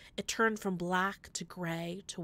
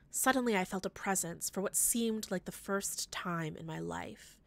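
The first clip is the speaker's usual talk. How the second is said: plain reading voice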